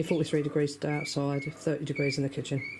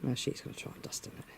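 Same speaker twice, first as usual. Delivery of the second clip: hushed, fast whisper; 2 semitones lower, 9 dB quieter, faster than usual. Real, not acted. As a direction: whisper so as not to scare the hen. Whispering while watching hen.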